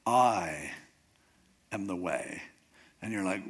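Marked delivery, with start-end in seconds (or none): 0.0-2.4 long, low "I" set apart by a pause; stress on I. Shown as a demonstration. Teacher showing the first week's word, stress on I. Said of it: "just emphasize the first word".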